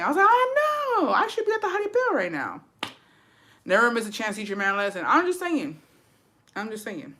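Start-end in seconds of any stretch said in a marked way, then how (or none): 0.3-2.6 exaggerated high-pitched griping; whiny complaint. Acts out her complaint to friend. 5.1-5.8 high-pitched innocent aside; light, high throwaway defence; playful disclaimer. Real, not acted. First take of repeated disclaimer. 6.6-7.1 quiet, trailing throwaway line; muted disclaimer. Real, not acted. Repeats disclaimer, dropped down.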